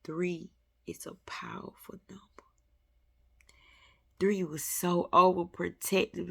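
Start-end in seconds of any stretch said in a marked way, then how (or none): 0.0-2.2 fast, whispered mystic aside; reverent whisper. Real, not acted. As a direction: hush it like a sacred secret.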